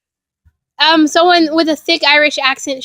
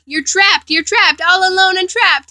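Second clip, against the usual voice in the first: creepy, lilting chant pitched up; a mildly creepy, gleeful taunt; taunting singsong; 5 semitones higher than usual. A narrator's reading. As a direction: taunt them in a light singsong. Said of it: "he sang merely".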